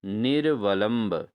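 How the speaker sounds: निर्वलंब said slowly, syllable by syllable; teacher stressing निर्वलंब; slow model word. Shown as a demonstration. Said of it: "तो वो option है आपका".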